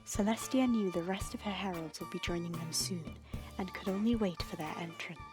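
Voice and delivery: ordinary delivery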